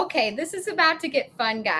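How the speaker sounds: ordinary talk, nothing marked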